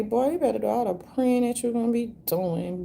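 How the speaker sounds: ordinary talk, nothing marked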